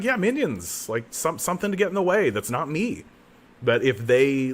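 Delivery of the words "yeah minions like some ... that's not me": mock eager. Mocking his own magic-user logic.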